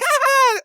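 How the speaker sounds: put-on loud, high-pitched girlfriend shriek; mock scream. Girlfriend on the roller coaster, mock scream. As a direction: shriek in imitation, full voice. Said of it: "She's like".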